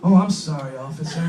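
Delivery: mock-coy, softening at the end; coy apology. Pulled-over driver to officer, mock-coy apology. Pleading coyly with a traffic officer.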